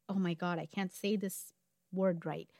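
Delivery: normal talking voice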